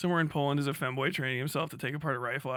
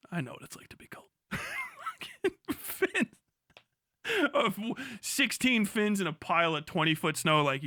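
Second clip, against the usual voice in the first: usual speaking voice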